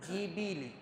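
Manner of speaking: جی بی لی each vowel kept short; soft short kasra vowels. Shown as a demonstration. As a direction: clip جی بی لی crisply. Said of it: "ایسے زیر ہے".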